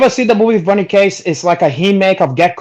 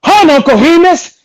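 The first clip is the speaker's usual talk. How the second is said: high, loud mocking call of a taunting killer; retelling a movie scene, a mock villain taunting his victim; hammed-up villain taunt; 12 semitones higher, 6 dB louder than usual. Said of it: "and he goes".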